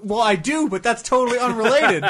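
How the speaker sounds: high, loud flustered outburst with laughs; splutter, caught out and indignant; flustered protest. Retold or imitated. Acting his own flustered reply.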